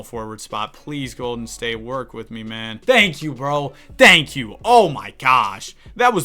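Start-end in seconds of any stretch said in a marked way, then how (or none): 2.8-4.6 relieved thanks. Real, not acted. Trade finally accepted, relieved. 4.6-6.2 exasperated. Real, not acted. Reacting to the terrible trades.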